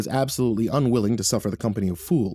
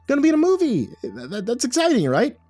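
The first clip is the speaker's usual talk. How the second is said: stammering false cheer; 12 semitones higher than usual. A narrator's reading. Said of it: "I held up my hands and stammered".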